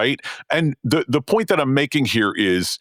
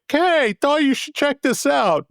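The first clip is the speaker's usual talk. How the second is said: high, slower breezy note; casual patron quote; slower than usual. Retold or imitated. Host quoting a patron's casual note, high and breezy. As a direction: lightly voice a patron's friendly message. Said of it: "It was really more just like".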